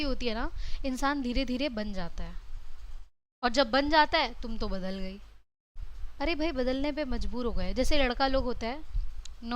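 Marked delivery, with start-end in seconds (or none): 4.4-5.2 lower, softer sulky accusation; a husband grumbling at his wife; mock husband complaint. (शिकायती पति की नकल)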